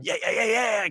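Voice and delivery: high-pitched mock hype; getting all pumped. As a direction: imitate someone getting fired up. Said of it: "getting all pumped".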